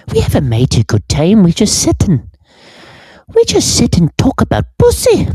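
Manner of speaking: high, jokey folksy voice; high folksy voice, hammed up for a laugh; put-on high voice.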